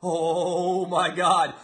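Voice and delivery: drawn-out mock gasp of fear; hammed-up terror at a tweet; mock trembling. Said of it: "as if I'm gonna be trembling".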